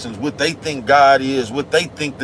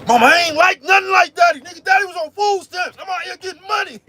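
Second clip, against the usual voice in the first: mad nephew shouting. Retold or imitated. Little nephew yelling back at his scolding mama, high and furious. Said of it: "He said".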